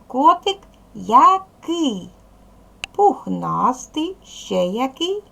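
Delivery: "Котик який?" stretched slowly, rising, asking the class; drawn-out questioning. Shown as a demonstration. Teacher questioning pupils about adjectives, slow and drawn out. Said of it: "Питаю".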